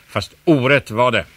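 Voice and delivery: ordinary delivery, nothing marked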